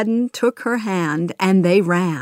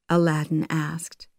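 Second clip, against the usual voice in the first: ordinary delivery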